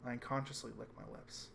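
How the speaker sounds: normal talking voice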